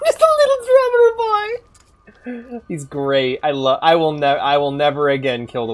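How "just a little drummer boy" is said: high, slow, cooing sing-song; high drawn-out baby-talk over the drummer. Pretend. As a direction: croon it like to a baby.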